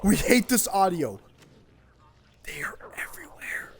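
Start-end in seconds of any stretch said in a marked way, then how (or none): 2.4-3.7 whispered, eerie and soft; spooky whisper. Pretend. Gamer hushing the audience about monsters, whisper. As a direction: breathe it like a ghost story. Spooky aside during game.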